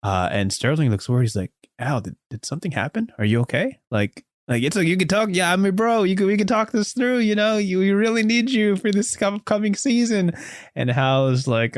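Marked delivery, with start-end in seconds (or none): 1.8-10.3 high, earnest bro voice, rising as he pleads; pleading teammate. Acted. Sterling begs Hal to stay on the team, high earnest plea. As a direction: beg him like a worried best friend, pitched up. Best friend pleading in story.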